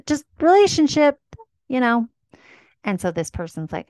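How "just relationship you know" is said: high, louder whiny voice; mock whiny insistence. Vague partner to date, high and whiny mock plea. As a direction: pout it out like a clueless partner.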